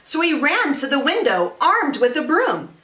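lively, singsong storytelling; picture-book read-aloud. A narrator's reading. Teacher reads a zombie picture book to families, animated read-aloud. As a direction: read it to children with rhythmic emphasis.

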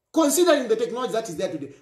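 very fast, running the words together; rushed repeat. Real, not acted. Speaker warning police about faked voices, rapid repeat. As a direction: rattle it off quickly the second time. Repeats phrase, second much faster.

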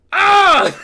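short, high, loud scream; dismayed scream. Real, not acted. Reacting to the panties scene.

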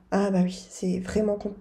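ordinary talk, nothing marked

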